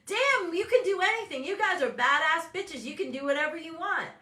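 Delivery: loud, high pep talk; pumping people up; hype coach. Retold or imitated. Coach rallying her clients, hyped and loud. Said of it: "I'm like".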